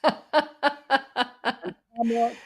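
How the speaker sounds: high, drawn-out laughing words; real laughter. Real, not acted. Storyteller to co-host after the punchline, laughing. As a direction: laugh right through the name.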